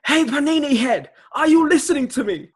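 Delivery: high, airy mock British chef; Gordon Ramsay. Host doing a chef impression on request, high airy mock scolding. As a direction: push it up into an indignant, airy scold. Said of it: "I need this one in your Gordon Ramsay impression".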